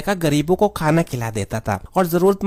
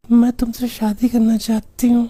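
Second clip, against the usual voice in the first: put-on high girlish phone voice; coy young woman voice; man doing a woman's voice; 3 semitones higher than usual. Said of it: "तुम्हारी आवाज तो बहुत अच्छी है".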